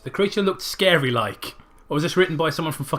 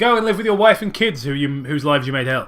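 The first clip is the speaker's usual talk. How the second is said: sarcastic; 3 semitones higher, 7 dB louder, faster than usual. Retold or imitated. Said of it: "It's like".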